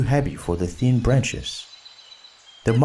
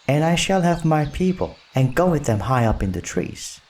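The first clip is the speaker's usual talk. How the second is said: mild shout, pitched higher; boy calling out boastfully, higher; 6 semitones higher than usual. Acted. Mowgli boasting to Baloo, a mild higher shout. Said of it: "shouted Mowgli".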